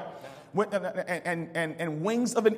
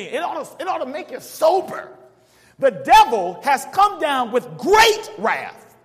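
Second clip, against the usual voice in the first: preaching at full force; 5 semitones higher, 8 dB louder than usual. Acted. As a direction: preach it out to the crowd. Preaching to congregation.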